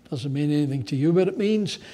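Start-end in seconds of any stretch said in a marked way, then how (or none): none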